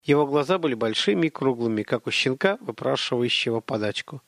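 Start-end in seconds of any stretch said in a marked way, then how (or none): none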